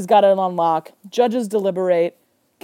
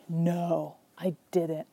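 dejected; 3 semitones lower, 11 dB quieter, much slower than usual. Retold or imitated. Eddie admits losing.

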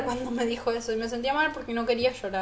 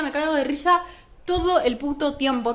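ordinary talk

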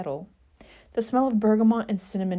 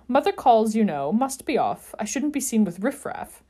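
louder, higher haughty excuse; strained lordly protest; 4 semitones higher, 5 dB louder than usual. Acted. Sirius clinging to the doorframe, strained haughty protest. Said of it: "his tinkling laugh strained now".

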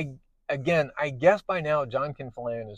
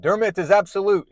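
raised, fervent declaration; emphatic proclamation; 8 semitones higher, 9 dB louder than usual. Retold or imitated. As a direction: proclaim it like a zealot. Zealot leader proclaims his faith.

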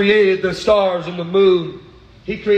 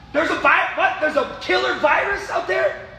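high, rising preacher's cry; preaching at full force; 6 semitones higher than usual. Acted. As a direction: build the sermon to a fever pitch. Preaching build to high pitch.

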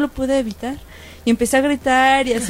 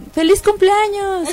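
cheerful mistaken greeting; 9 semitones higher, 3 dB louder, slower than usual. Retold or imitated.

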